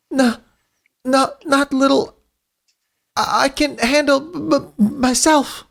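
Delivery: put-on a wounded kid insisting he's fine; hurt young Tails. Injured Tails telling the team he can cope, high and strained.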